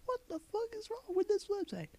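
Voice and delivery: high, shrill-pitched mock outrage; mock outraged commenter. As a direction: parody an angry commenter. Mocking commenter voice.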